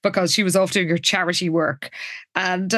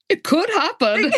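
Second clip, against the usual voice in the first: higher-pitched imitation of naive hope; delightfully hopeful; 9 semitones higher than usual. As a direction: play him timidly hoping against the odds. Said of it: "delightfully hopefully, says".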